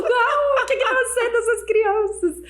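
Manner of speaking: high, slow, exaggerated crying voice; mock despairing wail. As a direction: draw it out in a hammed-up wail. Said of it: "ó, chorando". (choro desesperado imitado)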